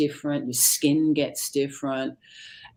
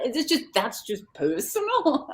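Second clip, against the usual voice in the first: laughing embarrassment; 6 semitones higher, 3 dB louder than usual. Real, not acted. Laughs at own quirk.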